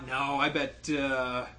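usual speaking voice

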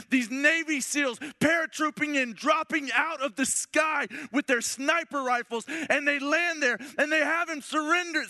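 high, loud excited storytelling; building excitement. Acted. Preacher narrating the Navy SEALs rescue to the men, excited and loud. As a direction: tell the rescue like an action trailer.

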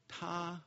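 "Ta" held high and level. Shown as a demonstration. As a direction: sing out "Ta" on one high, steady pitch. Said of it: "Four major tones".

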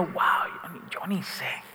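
a hushed inner voice; inner whisper. Retold or imitated. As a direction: whisper it like a private doubt. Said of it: "pero por dentro diciendo".

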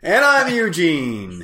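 slow, drawn-out show host intro; pepped-up intro. Acted. Host introducing himself on the podcast, slow and pepped up. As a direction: perk up the name like a host. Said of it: "Oh, pepping it up there".

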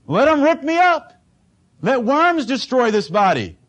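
high, loud cry of surrender; defiant cry. Retold or imitated. Preacher voicing Job's cry, high and loud.

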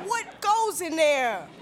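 high, slow, incredulous question; comic alarm. Retold or imitated. Woman eyes fiance's huge baggage, comic astonishment. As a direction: stretch it out high in disbelief.

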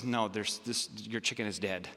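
low, soft bad-news voice; subdued vet. Vet telling owner her chicken died, subdued. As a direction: break it quietly, pitched well down.

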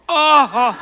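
high, loud laughing gasp; embarrassed surprise. Real, not acted. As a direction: caught out and laughing. Learns Mark already called.

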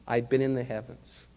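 usual speaking voice

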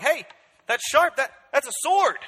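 startled victim. Retold or imitated. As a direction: squeal it like you've just been cut. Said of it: "we'll be like".

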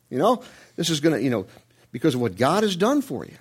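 ordinary talk

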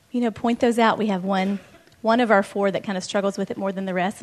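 normal talking voice

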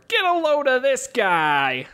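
Boston cab driver voice; Boston accent. Retold or imitated. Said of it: "I can't do a Boston accent".

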